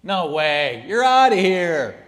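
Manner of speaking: loud emphatic no; firm loud rejection; defiant shout. Acted. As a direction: shout the excuse away. His defiant retort to excuses.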